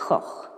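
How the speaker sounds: "Gogh" said the Dutch way, with a rasping throat G at both ends. Shown as a demonstration. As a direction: give "Gogh" its real Dutch sound, throaty G, short o. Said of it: "the real Dutch is definitely".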